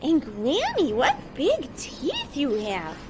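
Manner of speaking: put-on high, slow, amazed girl; wondering little girl.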